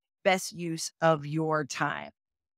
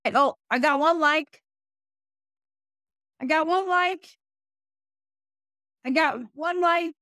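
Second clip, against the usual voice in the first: excited; 8 semitones higher, 4 dB louder than usual. Real, not acted. Reading a viewer's chat comment.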